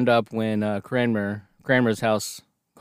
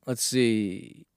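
normal talking voice

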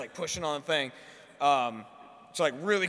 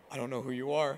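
lower, softer quoted line; deadpan bystanders; 6 semitones lower, 7 dB quieter than usual. Retold or imitated. Silent onlookers face the puncher, quiet low deadpan. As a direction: say it quietly as a stunned crowd. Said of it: "they go".

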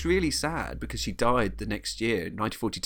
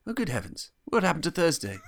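higher-pitched mock surprise; flustered absent-minded academic; 7 semitones higher than usual. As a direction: act out a dithering, bumbling professor. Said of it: "definitely a bit Walter Mittery".

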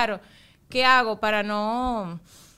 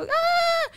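short high-pitched scream, louder; mock scream; 5 dB louder than usual. Mother showing how she screams at that moment, mock scream. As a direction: re-enact a sudden scream of frustration. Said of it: "yo ahí mismo grito".

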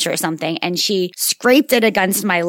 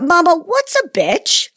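put-on toddler voice; 8 semitones higher than usual. Repeats toddler's question.

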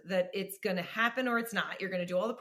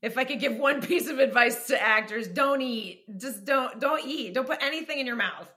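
mock solemn advice; 5 semitones higher, 6 dB louder than usual. Real, not acted.

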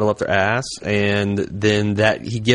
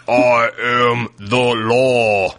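Judge Dredd line; much slower than usual. Retold or imitated.

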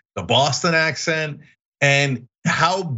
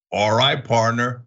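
put-on lower-pitched cowboy-style American accent; put-on Western accent, pitched down; mock American accent; 4 semitones lower than usual. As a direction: imitate tourists playing an American cowboy. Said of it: "And they're like".

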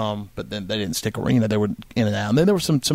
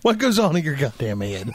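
laughing; 3 semitones higher than usual. Real, not acted. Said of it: "You're smiling".

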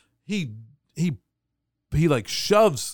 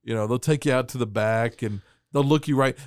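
ordinary delivery

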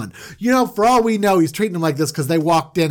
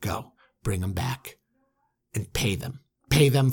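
weary defeated father; 5 semitones lower, 6 dB quieter than usual. Retold or imitated. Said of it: "And he's like".

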